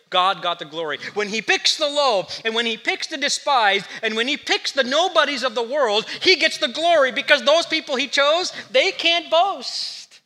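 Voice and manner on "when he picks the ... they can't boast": preaching build. Acted.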